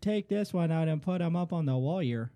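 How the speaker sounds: broad mock Canadian accent. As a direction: play a folksy Canadian. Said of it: "I can't do that accent".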